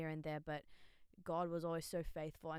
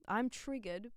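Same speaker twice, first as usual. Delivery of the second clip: I'm triggered, said higher with a rising end; higher, rising repeat; 6 semitones higher, 6 dB louder, slower than usual. Shown as a demonstration. Vlogger repeating to viewers, higher and rising. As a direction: lift "I'm triggered," up and let it rise. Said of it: "but I'm triggered".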